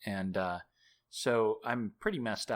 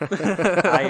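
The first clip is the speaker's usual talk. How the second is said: higher, louder nervous laugh; embarrassed real laughter; 8 semitones higher, 13 dB louder than usual. Real, not acted. Embarrassed laugh about the story.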